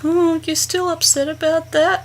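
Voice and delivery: a hammed-up high voice for the girlfriend; mocking Audrey. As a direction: toss it off lightly like a ditz. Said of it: "And she's like".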